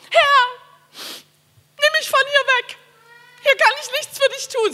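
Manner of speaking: high, loud, slow theatrical wail; hammy stage actor. Shown as a demonstration. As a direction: ham it up like a tragic actor. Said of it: "Ich kann sehr theatralisch beten".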